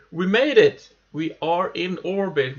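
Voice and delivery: loud joyful we made it; triumphant. Real, not acted. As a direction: exclaim in victory. Reached orbit.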